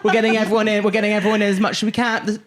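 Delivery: high, hurried crowd-control voice; harried elf at the cinema door, high and flustered. Retold or imitated. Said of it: "I was a bit like".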